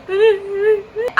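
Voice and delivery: high, whimpering mock cry; imitated sobbing. Tourist still crying at the stranger's pep talk, mock sobbing. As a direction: respond with short fake sobs. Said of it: "of me just crying".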